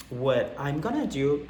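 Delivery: normal talking voice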